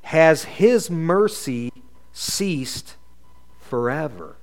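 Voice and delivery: very slow, drawn-out lament; mildly acted, slow crying out. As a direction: stretch each word of the question like a prayer. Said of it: "Third question David cries out".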